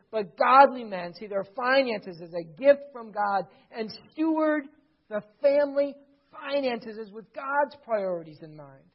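loud, driving sermon delivery; preaching. Acted. Preacher urging the men at full force, loud.

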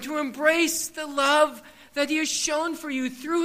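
the pastor preaching eternal life to the congregation. Acted. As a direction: build through each clause. Preaching build on the gospel.

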